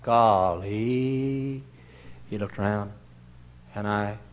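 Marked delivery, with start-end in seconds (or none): 0.0-1.6 awed golly. Retold or imitated. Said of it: "He said".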